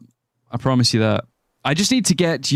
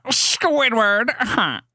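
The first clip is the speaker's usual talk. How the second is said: Squidward voice; 9 semitones higher, slower than usual. Pretend. Sudden cartoon character voice.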